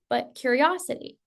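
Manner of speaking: normal talking voice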